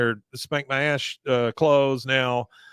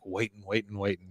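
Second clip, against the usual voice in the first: hushed waiting; 5 semitones lower, 11 dB quieter than usual. Retold or imitated. Hank waits at the glass, hushed and low. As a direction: let it fade to near silence.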